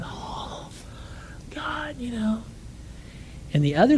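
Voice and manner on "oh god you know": tearful whisper. Retold or imitated. Lady bawling, whispered.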